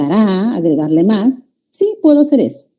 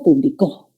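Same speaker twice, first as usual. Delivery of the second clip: "publicó" stressed on the last CÓ; pu-bli-CÓ, the past-tense verb; stress on final syllable; 6 semitones lower than usual. Shown as a demonstration. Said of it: "cambiándole la ubicación del acento".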